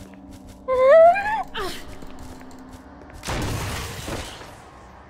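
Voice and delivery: long shrill laughing wail; high crying laugh. Real, not acted. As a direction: break into a high laughing cry.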